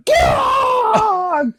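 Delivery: very high, strangled cry of pain; hammed-up agonized wail; pained yell. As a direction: imitate a man hit hard in the chest. Imitating the man he nailed.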